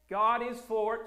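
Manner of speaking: ordinary delivery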